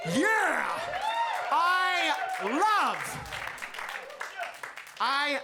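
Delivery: high, emphatic cheer; elated shout. Real, not acted. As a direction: whoop it out to the crowd. Cheering after declaring deep love.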